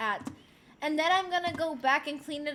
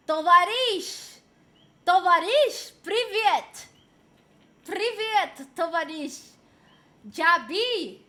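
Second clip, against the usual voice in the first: loud, punchy mock-Russian exclamations; playful Russian accent, barked out loud and higher; 4 semitones higher, 8 dB louder, slower than usual. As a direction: shout it in a hammed-up Russian accent. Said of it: "Russian accent?".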